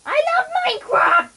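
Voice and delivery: excited outburst. Real, not acted. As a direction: blurt it out with pure glee. Gleeful outburst about favourite game.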